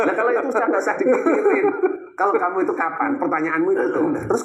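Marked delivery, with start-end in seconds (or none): 0.0-1.6 talking through laughter; laughing. Real, not acted. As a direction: chuckle through the joke. Joking about anger in hell.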